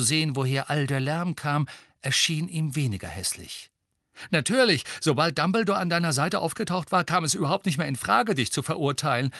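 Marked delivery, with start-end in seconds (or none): 4.3-9.3 lifted, higher, happy voice. A narrator's reading. Ron delighted for his cleared friend, happy. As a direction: say it elated, pitched up. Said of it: "sagte Ron glücklich".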